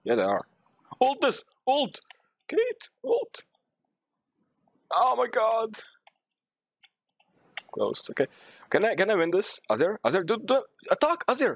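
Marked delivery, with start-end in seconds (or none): 1.0-5.8 high-pitched frantic yells; exasperated high gamer shouting; urgent calling out. Real, not acted. Calling urgently to teammates mid-fight. 8.7-11.5 high, stammering excitement; urgent high calls mid-fight; frantic urging. Real, not acted.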